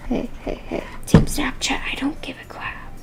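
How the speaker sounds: hushed aside whisper. Real, not acted. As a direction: whisper it off-mic. Whispered aside while reading.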